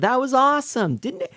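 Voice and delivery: high, loud gushing praise; thrilled high-pitched compliments to a friend; excited praise. Real, not acted. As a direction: cheer your friend on, pitch way up. Praising friend's song on stream.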